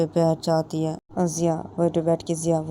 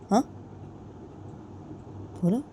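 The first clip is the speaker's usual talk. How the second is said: ordinary delivery, nothing marked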